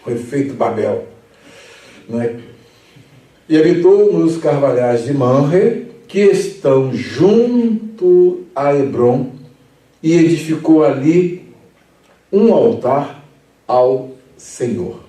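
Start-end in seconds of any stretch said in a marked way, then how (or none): 3.5-15.0 slow, weighty Bible reading, a touch louder; solemn scripture reading. Acted. Preacher reads the verse aloud to the Bible class, solemn and slow. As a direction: read it as holy text, slowly.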